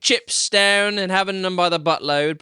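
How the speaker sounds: exaggerated British accent attempt; mock British accent. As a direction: roll it out in broad put-on British. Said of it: "Can we play it in British accents".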